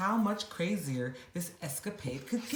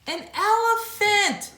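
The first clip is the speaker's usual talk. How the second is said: higher, louder, slow delighted cry; cried; 8 dB louder than usual. Acted. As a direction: elephant exclaiming in joyful surprise. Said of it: "the creature cried".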